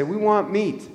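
mock complaining Israelites; whining crowd.

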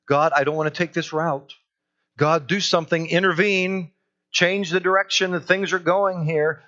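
acted-out desperate plea; urgent pleading prayer. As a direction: beg for intervention, building in force. Acts out desperate prayer to God.

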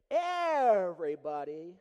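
booming, slow "Everybody"; shouted. Acted. Preacher booms to congregation, loud and slow. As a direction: throw it out to the whole room. Preacher stresses grumbling crowd.

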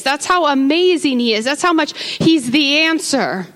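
loud, rising sermon praise; rousing preacher in full flow; preaching at full force. Real, not acted.

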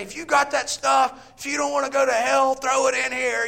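loud, high mock yell of a preacher; camp preacher yelling. Preacher at campfire demanding CDs, imitated yell. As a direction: bellow it at the fire like a revivalist. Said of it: "they would say".

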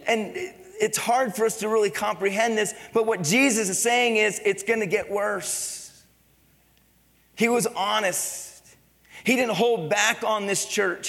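full-force sermon delivery; fervent preaching. Acted. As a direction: preach it out to the congregation.

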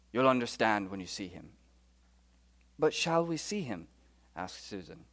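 soft British storybook dialogue; low British narration after the question; British accent. Acted. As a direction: read it quietly in a British accent. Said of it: "in my very best British accent".

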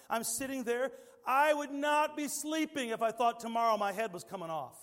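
high, loud preaching voice; full-force preaching. Acted. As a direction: preach it with fire.

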